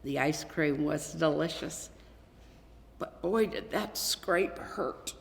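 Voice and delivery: usual speaking voice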